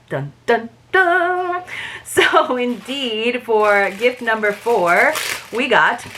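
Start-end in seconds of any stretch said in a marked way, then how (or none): 0.1-1.6 dun-dun-dun, last one held high; hammed-up cliffhanger sting; mock suspense sting. Reader teasing viewers after the missing money, mock-dramatic sting.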